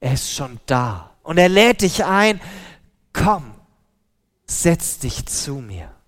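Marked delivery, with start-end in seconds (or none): 3.1-6.0 gentle invitation. A narrator's reading. Jesus invites listener gently.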